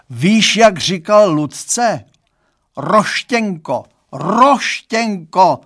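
loud, high, drawn-out wail; lamenting. A narrator's reading. Father lamenting the nickname given to his daughter, wailing. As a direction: wail it slowly in despair and disgust. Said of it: "zanaříkal a ukryl hlavu".

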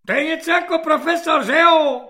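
high, loud, fast gloating; gleeful corporal. Acted. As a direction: crow with delight. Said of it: "zaraduje se svobodník".

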